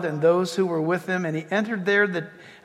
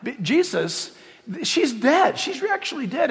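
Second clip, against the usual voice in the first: ordinary talk, nothing marked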